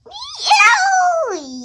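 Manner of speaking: high loud accusing "You!"; outraged shout. Acted. Character shouting at the player who threw him, outraged. Confronting the thrower in game.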